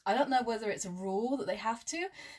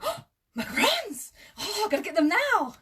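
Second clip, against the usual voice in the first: much higher and louder mum squeal; excited mum; 12 semitones higher, 9 dB louder than usual. Retold or imitated. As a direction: imitate the mum thrilled to find macarons. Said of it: "she was quite excited".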